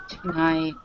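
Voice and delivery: ordinary talk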